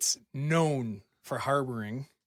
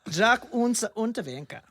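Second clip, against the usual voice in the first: name said in a hammed-up German accent, higher and louder; best German accent; 9 semitones higher, 5 dB louder than usual. Shown as a demonstration. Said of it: "in my best accent".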